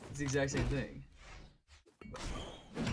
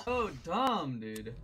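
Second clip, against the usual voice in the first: usual speaking voice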